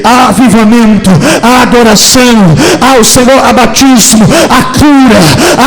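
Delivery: loud, driving Pentecostal proclamation; pregação a plena força. Real, not acted. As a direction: declare each blessing forcefully in rhythmic phrases. Preaches at full force. (pregação pentecostal inflamada e profética)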